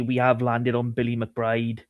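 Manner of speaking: ordinary talk, nothing marked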